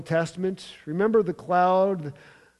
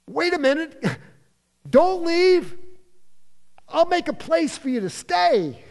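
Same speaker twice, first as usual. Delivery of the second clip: high, louder, anxious plea; pleading Peter; 10 semitones higher, 3 dB louder than usual. Acted. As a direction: beg them not to go, voice pitched up. Peter pleading with Moses, Elijah.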